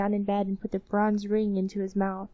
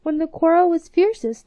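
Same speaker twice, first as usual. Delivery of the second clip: ordinary delivery, nothing marked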